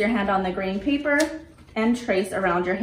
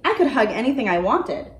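storybook reading voice with character turns; reading to children. A narrator's reading. Librarian reading a dinosaur story aloud to children, gentle storytelling. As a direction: read it like a picture book for little ones.

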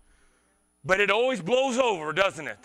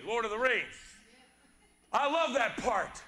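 ordinary delivery

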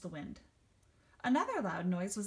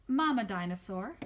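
plain reading voice

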